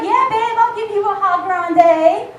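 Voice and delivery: hammed-up flirty barista; cheesy flirt. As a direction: lay on the charm.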